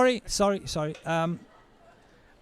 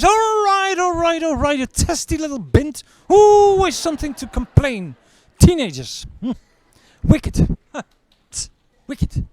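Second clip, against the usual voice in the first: much higher, louder grumbling caricature; exasperated doctor; 11 semitones higher, 7 dB louder than usual. Acted. Doctor dismissing the bruised daughter, high exasperated mock. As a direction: sneer at the whiny teen.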